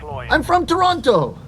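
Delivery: hammed-up exasperated reply; mock frustration. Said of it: "It's like".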